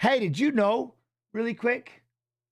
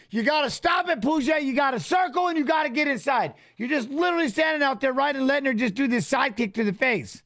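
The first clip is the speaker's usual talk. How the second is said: coaching shout; 5 semitones higher than usual. Real, not acted. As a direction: yell advice at the fighter from the couch. Yelling advice at fighter Pooja.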